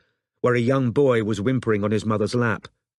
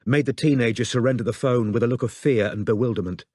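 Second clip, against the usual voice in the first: plain reading voice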